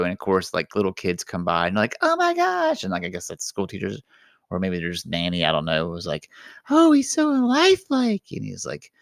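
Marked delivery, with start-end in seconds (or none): 2.0-2.8 very high squeal of amazement; kids gasping. Retold or imitated. Little kids spotting the duck, high squeal. 6.7-8.2 very high, cooing admiration; gushing nanny. Retold or imitated. Nanny marvelling at the lifelike duck, high and gushing.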